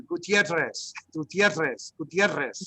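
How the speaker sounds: Gutierrez with a t slipped before the rr; Gutierrez drill. Shown as a demonstration. Teacher drilling Gutierrez for students who can't roll. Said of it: "throw that T in there".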